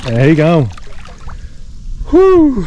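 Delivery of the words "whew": loud, high "whew"; high whoop. Real, not acted. Angler celebrating a catch, high loud whoop. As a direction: let out a high, drawn whoop. Relief after landing a fish.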